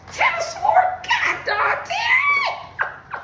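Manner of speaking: card shock shout; shocked outburst. Real, not acted. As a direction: yelp it out, stretching the curse. Reacts to the drawn card.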